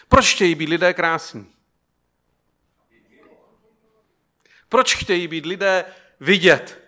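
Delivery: loud, high, driving sermon; preaching at full force. Acted. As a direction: hammer the point home to the congregation.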